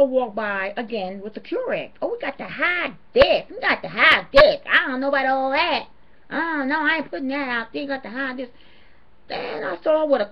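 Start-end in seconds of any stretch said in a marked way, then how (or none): 2.0-8.5 loud, high mock of a greedy worker. Vlogger mocking the Goodwill worker, loud and high. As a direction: ham up the sneaky worker grabbing stuff. Mimicking worker hiding Keurig.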